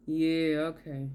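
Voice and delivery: low, trailing bored reply; glazed-over indifference. Acted. Narcissist losing interest when talk isn't about them, bored. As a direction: tune out and fade away. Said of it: "Their eyes were like".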